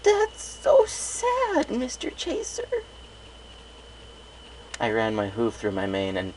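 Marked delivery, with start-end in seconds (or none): 0.0-2.8 high, slow, tearful filly voice; a little unicorn welling up with tears; blubbering. A narrator's reading. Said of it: "The little unicorn started blubbering".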